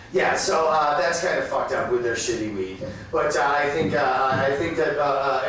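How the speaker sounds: ordinary delivery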